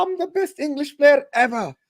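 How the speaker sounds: high, slow, gloating voice; boastful mock quote. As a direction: crow it like a smug winner. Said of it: "they will go".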